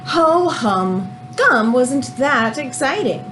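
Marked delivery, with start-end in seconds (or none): none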